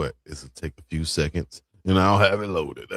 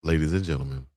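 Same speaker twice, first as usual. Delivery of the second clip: low show host sign-off; announcer voice; 3 semitones lower than usual. Acted. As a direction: roll it out like a broadcast host. Show outro announcer read.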